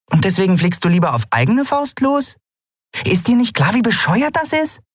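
high-pitched, indignant questioning; exasperated scolding. A narrator's reading. Friend berating pilot for flying off alone, exasperated.

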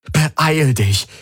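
high-pitched urgent plea; pleading. A narrator's reading. Man on the comm begs Ylana to come, pleading. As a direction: beg her to hurry. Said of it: "flehte er".

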